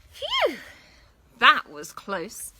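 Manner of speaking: put-on relieved girl. A narrator's reading. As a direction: sigh it out after escaping. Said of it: "said Katy".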